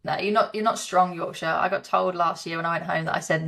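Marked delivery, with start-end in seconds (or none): none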